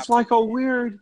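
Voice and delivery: very high put-on actor voice; Jimmy Stewart. Podcaster showing how the character talks, high impression. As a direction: slip into a high, odd impression. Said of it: "a little like Jimmy Stewart".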